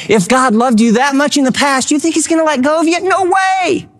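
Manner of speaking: rising high to an emphatic no way; incredulous. Real, not acted. Preacher challenging doubters about God's love, incredulous. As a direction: build in pitch, quickening into disbelief. Rhetorical climax of sermon.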